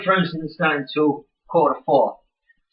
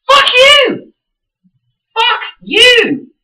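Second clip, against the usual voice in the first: much higher and louder, a cracking scream; screaming fuck you; 7 dB louder, much slower than usual. Retold or imitated. Furious fan screaming at the mocking celebrities, voice cracking. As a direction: scream it at them, voice breaking.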